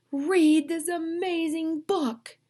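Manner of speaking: high, loud, slow enthusiastic order; emphatic command. Acted. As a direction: urge it with great excitement. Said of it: "They're telling me that I have to read".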